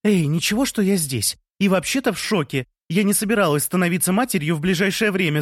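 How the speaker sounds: high-pitched protest; indignant shout. A narrator's reading. Lea breaking into the balcony celebrations, shouting indignantly in a high voice. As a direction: cry out in protest, voice pushed up. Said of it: "закричала Лея".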